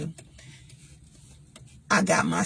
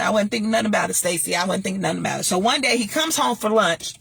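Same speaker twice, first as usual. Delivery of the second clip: ordinary delivery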